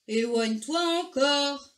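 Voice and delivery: slow, loud call in whale voice; whale's shout. A narrator's reading. Whale calls to Argento to move back, slow shout. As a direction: call out big and drawn out. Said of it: "lui cria la baleine".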